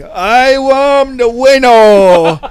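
loud, high, slow victory shout; triumphant shout. Retold or imitated. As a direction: belt it out like a winner. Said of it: "you wanted him to just go out like".

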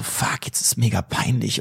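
slow embarrassed whisper; whispered thought. Retold or imitated. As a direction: whisper it slowly, mortified. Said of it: "dann denkst du doch auch so". (langsam und verlegen geflüstert)

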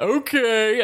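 high, mock-placating okay; drawn-out okay. Player answering the old guy's accusation, high exaggerated okay. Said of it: "I did the".

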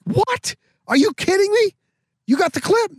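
very high-pitched, incredulous mock reaction; imitating disbelief. Host learns the crank callers sent a clip, retold in high disbelief. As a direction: voice leaps up, acting out his astonishment. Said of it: "He could not believe it".

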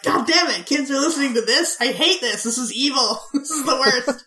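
hammed-up indignant rant, tipping into laughter; mock angry. Parents hearing Kiss's terrible songs, mock angry. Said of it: "it's making me angry".

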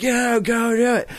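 high, excited egging on; a hyped-up friend cheering from the side. Retold or imitated. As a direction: egg your buddy on to take the hit. Retold friend cheering him on.

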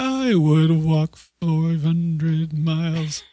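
slow, drawn-out gruff line; slow superhero voice. Pretend. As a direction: stretch it out in a mock tough voice. Joking threat, slow stretched.

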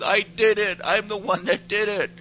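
high, loud mock-crying blurt; tearful confession. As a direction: reenact a crying child confessing. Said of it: "And I start crying".

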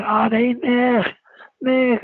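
put-on high, bright-pitched girlish greeting; lightly voiced Liverpool girl calling out; imitating the Scouse girl. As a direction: put on the girl's Liverpool call of his name. Poppy greets him, Scouse girl.

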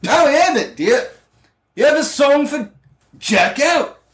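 very high, squawky put-on voice; bar patron. Bar patron answers the bard, in a very high voice. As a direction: pipe up in a silly high-pitched voice. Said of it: "one of the bar patrons said".

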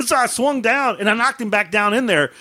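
ordinary talk, nothing marked

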